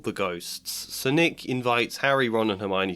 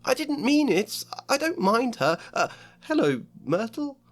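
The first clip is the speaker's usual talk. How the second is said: nervous schoolgirl; 12 semitones higher than usual. Acted. Hermione backpedals nervously.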